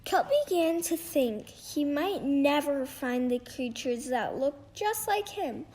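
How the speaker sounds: ordinary delivery, nothing marked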